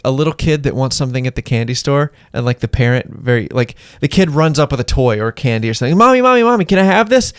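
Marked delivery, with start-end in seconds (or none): 6.0-7.3 put-on high, loud whiny kid pleading; excited kid begging. Child begging mom for candy store toy, loud and whiny. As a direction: beg like an eager little kid.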